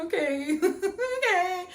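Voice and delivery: high teary laugh-cry through the words. Retold or imitated. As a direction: give in between tears and a laugh. Crying on phone with mom.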